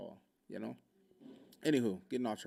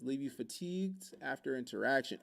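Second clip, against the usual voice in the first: ordinary talk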